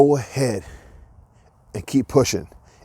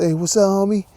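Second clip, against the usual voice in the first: homeless guy voice; 7 semitones higher than usual. Retold or imitated. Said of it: "I like the voices".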